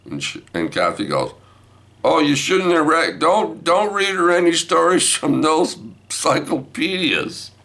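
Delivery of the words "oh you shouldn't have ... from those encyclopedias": high-pitched mock reproach; scolding. Wife scolding Grandpa over encyclopedias, high voice. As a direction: tell off in a raised, insistent voice.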